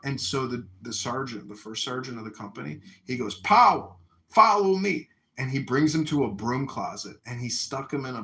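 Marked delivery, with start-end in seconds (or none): 3.4-5.1 high, loud sergeant's command; barked order. Retold or imitated. First sergeant orders Powell out of line, barking loudly.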